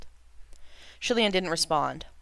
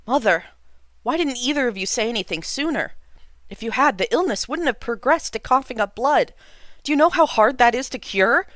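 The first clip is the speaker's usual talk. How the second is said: higher and louder, building frustration; distraught reproach; 8 semitones higher, 7 dB louder than usual. A narrator's reading. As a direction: son reproaching his mother in distress. Son upset at the hidden illness.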